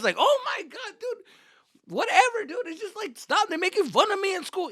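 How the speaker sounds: high, loud whining outburst; acted teen exasperation. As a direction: complain shrilly to mom, fed up. Acted teen quarrel with mother.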